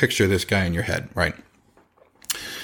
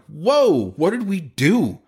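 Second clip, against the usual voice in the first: surprised; 9 semitones higher, slower than usual. Retold or imitated. Owner reacting to customer's rant.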